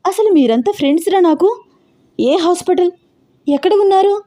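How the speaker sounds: high, upset questions in disbelief; a shaken friend pressing for the hospital; shocked disbelief. A narrator's reading. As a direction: demand answers, stunned and hurt. Shocked by friend's accident news.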